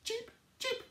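high squeaky chick cheeps; bird cheeps. Acted. Teacher voicing baby birds for the children. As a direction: peep it like a tiny bird. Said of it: "One little bird says".

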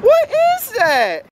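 high, slow astonished cry; shocked. Real, not acted. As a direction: drag out the disbelief.

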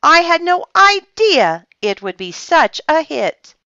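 high, loud, boastful delight; proud. A narrator's reading. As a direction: show off your success. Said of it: "said Francine proudly".

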